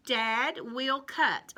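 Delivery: Dad will cut said slowly, each word set apart; slow, deliberate. Shown as a demonstration. Teacher building the sentence slowly for children. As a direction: lay out the words for kids. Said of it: "building the sentence with class".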